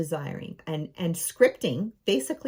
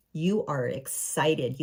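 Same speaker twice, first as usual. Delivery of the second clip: ordinary delivery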